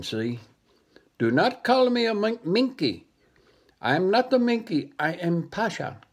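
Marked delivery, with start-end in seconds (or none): 1.2-6.0 higher indignant monkey voice; monkey correcting the dog, higher and offended. Acted. Monkey scolding the dog for calling him a monkey, higher and indignant.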